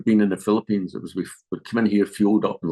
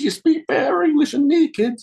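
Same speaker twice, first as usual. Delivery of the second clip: much higher and louder, amazed exclamation; amazed Texan; 6 dB louder than usual. Retold or imitated. Texan stunned by the boy's English, amazed high loud cry. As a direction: burst out in a far higher, louder voice.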